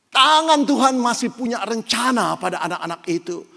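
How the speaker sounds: high, loud, full-force sermon delivery; fiery preaching. Acted. Preacher proclaims to the congregation that God is good, loud and fervent.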